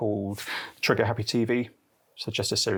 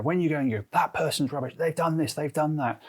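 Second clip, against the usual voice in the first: lightly voiced griping, pitched higher; hammed-up blame, pitched up; mock complaining; 6 semitones higher than usual. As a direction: imitate a whiny accuser. Mimics someone blaming others.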